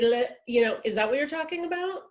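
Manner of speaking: ordinary talk, nothing marked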